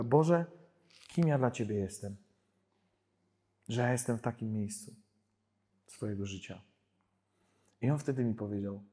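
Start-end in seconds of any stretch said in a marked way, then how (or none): 0.0-6.6 retold tearful prayer. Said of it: "płakałam i wołałam do Boga".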